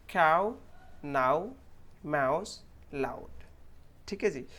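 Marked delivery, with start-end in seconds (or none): 0.1-3.3 one word at a time, full au glide; clear "au" sound. Shown as a demonstration. Teacher repeating for learners, each au clearly sounded. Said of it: "मैं दोबारा pronounce करके दे रहा हूँ".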